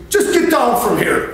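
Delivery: a high, loud, mock cop order; lightly voiced cop telling him to come down; bossy cop.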